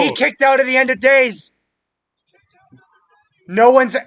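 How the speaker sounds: high, loud stunned yell; excited shout. Real, not acted. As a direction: shout it in total disbelief. Stunned at a wrestling upset.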